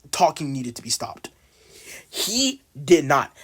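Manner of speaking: ordinary talk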